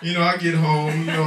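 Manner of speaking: normal talking voice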